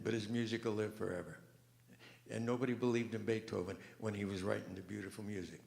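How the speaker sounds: usual speaking voice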